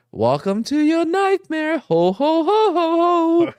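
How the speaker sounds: high, hammy haunted-house greeting; mock-spooky welcome with a drawn-out ho-ho laugh; Gloria. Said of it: "Gloria pops out and she goes".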